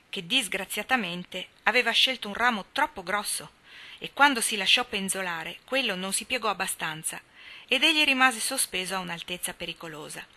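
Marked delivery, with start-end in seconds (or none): none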